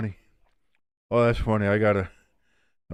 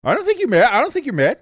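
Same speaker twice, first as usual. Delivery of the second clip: high, louder sarcastic teasing; 12 semitones higher, 6 dB louder, faster than usual. Real, not acted. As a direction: needle him with mock disbelief. Teasing angry friend.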